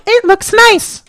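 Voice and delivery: imitating a girl praising his beard, high; girls' compliment. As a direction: mimic a girl gushing sweetly. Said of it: "and they're like".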